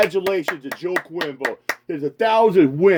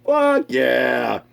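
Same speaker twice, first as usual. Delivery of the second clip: ordinary delivery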